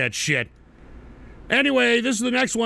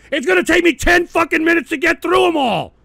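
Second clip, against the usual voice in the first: higher and louder, fed up; exasperated rant; 8 semitones higher, 6 dB louder than usual. Real, not acted. Streamer fed up with viewer's video list, ranting louder. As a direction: vent hard at the pile of videos.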